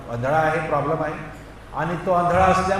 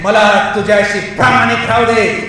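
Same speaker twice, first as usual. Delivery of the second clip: building from raised voice to high shout; mounting preacher's cry; fervent prayer; 8 semitones higher, 9 dB louder than usual. Acted. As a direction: plead harder with every phrase. Prayer builds to full force.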